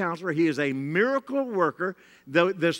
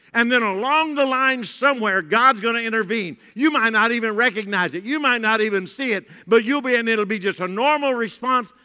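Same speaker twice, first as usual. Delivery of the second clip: louder, rousing preacher voice; preaching at full force; 4 semitones higher, 5 dB louder than usual. Real, not acted. Pastor urging the church to obey God, rousing. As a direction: drive the congregation past their fear.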